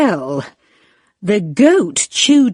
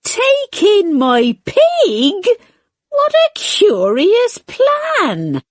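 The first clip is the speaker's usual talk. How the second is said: put-on disbelieving old lady; 9 semitones higher than usual. A narrator's reading. Old lady doubts the wise man's plan, higher and tearful. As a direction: question it near tears. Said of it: "said the wise old man".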